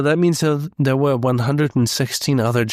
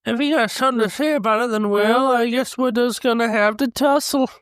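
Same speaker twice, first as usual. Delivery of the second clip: hillbilly Shaggy; 10 semitones higher than usual. Pretend. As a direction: play an unsure country bumpkin. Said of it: "hillbilly version of Shaggy".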